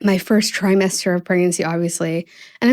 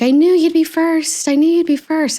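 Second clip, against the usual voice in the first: tearfully happy friend; 9 semitones higher, 3 dB louder than usual. Retold or imitated. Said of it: "like, sobbing, so happy for me".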